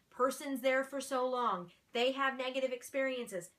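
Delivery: ordinary talk, nothing marked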